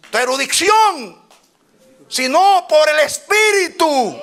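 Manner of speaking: high, loud, slow shouting preacher; preaching at full force. Acted. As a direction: drive it home like a fiery preacher. Preaching climax.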